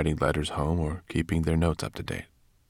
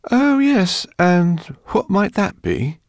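much higher, louder mock-innocent question; professor's polite inquiry; 14 semitones higher, 8 dB louder than usual. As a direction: play the cool, curious professor facing the jaws. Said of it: "he inquired".

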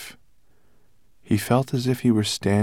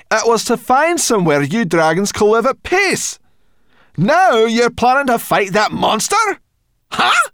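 high, loud, outraged scolding; indignant brownie girl; 10 dB louder than usual. Acted. Brownie girl scolding the dragon over his fighting plan. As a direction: tell him off. Sorrel objects.

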